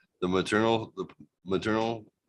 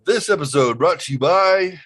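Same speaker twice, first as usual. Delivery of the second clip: high, loud announcer for a fake ad; hammed-up commercial voice; mock sponsor read; 9 semitones higher, 7 dB louder than usual. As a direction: sell it like a sponsor spot. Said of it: "What's another commercial tag we can do".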